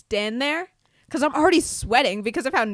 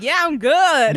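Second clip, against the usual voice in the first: higher, louder forced brightness; faking fine; 5 semitones higher, 6 dB louder, slower than usual. Retold or imitated. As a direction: pretend you're not winded. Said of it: "I'm like".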